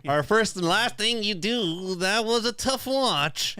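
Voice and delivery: slow, high anime narrator voice; hammy next-episode teaser; Dragon Ball announcer voice. Acted. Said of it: "What are you reading".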